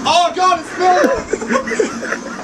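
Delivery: high, loud, laughing at the smell; laughing disgust. Real, not acted. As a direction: laugh out the complaint. Reacting to the stench.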